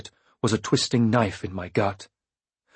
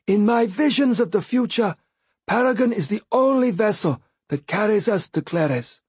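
higher, lighter voice for the Fool; Fool's voice; 11 semitones higher than usual. A narrator's reading. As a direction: voice him light and knowing.

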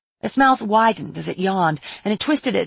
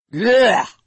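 loud, higher blurt of distaste; disgusted; 4 semitones higher, 5 dB louder than usual. A narrator's reading. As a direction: spit it out as if it tastes foul. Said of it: "The Patriarch made a face".

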